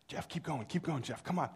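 hushed, conspiratorial egging-on; imitating brother's urging. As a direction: egg him on softly so dad won't hear. Brother egging him on secretly at night.

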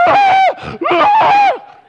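very high, loud imitated scream; mock scream. Imitating the winner screaming on the ground, piercingly high and loud. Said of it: "He's screaming".

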